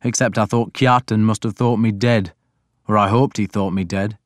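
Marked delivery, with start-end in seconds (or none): none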